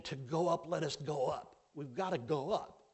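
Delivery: rally the people to go now; a hint of a bold warrior pushing forward; Caleb urging. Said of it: "Caleb is saying".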